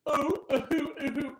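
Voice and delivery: high, fake sobbing oh's with a laugh; hammed-up imitation of poor on-screen crying; mock bad crying. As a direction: parody a wooden actress crying. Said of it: "even when she cries, it's like".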